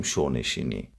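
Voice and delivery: شب نشینی said "show-neshini"; شو as "sho", the b dropped; northern dialect form. Shown as a demonstration. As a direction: demonstrate regional شو for شب, "show".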